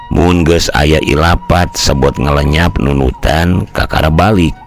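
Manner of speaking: usual speaking voice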